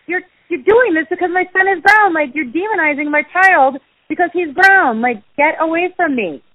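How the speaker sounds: high, heated retelling of a confrontation; indignant call-out. Retold or imitated. Mother calling out people demonizing her child, indignant. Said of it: "I call it out".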